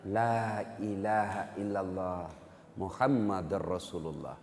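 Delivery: slow, low, soft dying words; laboured utterance. Acted. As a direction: force out the words slowly and quietly. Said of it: "dengan susah payah mengucapkan". (ucapan pelan dengan susah payah)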